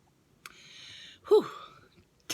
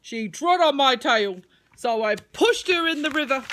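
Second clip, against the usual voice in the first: worm voice; 5 semitones higher, 9 dB louder than usual. Acted. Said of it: "said Mudwiggle".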